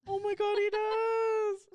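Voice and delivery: high, loud, drawn-out squeal; excited squeal. Real, not acted. Agreeing with co-host about Lapras's voice, excited squeal. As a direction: squeal with delighted recognition.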